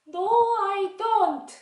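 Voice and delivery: very high, loud, drawn-out no; puppet child refusing. Pretend. Child puppet refusing vegetables, high and drawn out. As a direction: whine it out slowly like a stubborn kid.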